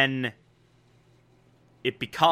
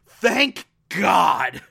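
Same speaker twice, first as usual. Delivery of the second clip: relieved exclamation; 4 semitones higher than usual. Real, not acted. Telling viewers Boz lived, relieved shout. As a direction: burst out with real relief.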